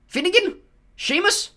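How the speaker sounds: loud, high name call. Acted. McGonagall calls a pupil forward, loud and high. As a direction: call the name across the hall. Reading names to the hall.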